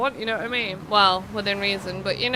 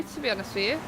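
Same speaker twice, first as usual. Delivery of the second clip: normal talking voice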